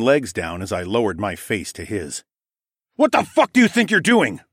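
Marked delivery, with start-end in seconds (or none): 3.0-4.4 high, loud, furious demand; angry growl. A narrator's reading. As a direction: growl it at someone you've pinned down. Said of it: "I growled".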